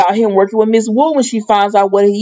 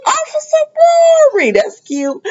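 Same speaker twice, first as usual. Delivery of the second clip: sing-song high teasing call; high squealed tease. Real, not acted. Teasing reaction to nickname.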